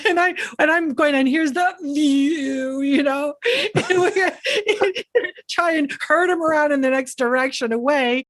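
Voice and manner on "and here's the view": higher-pitched, stretched-out house-tour voice; awkward sales pitch. Retold or imitated. Showing clients the view past a film shoot, awkward high-pitched pitch. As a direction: keep the tour going, high and slow.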